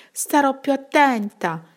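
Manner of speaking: a lightly voiced timid hare; meek hare. A narrator's reading. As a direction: answer like a chastened little hare. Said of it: "disse la lepre".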